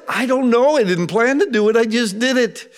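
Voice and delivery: high, childlike mock defense; helpless protest. Adult explaining an unplanned act, helpless and high.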